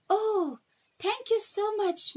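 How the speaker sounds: put-on high, bright gushing child voice; delighted little girl. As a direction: gush thanks like an overjoyed small girl. Girl thanks raccoon delightedly.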